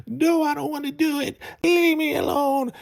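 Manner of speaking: very high squeaky crying voice; crying little boy. Retold or imitated. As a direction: squeal it like a terrified child. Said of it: "crying and shivering".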